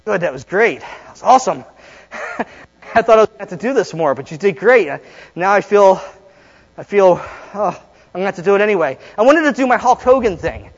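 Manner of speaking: normal talking voice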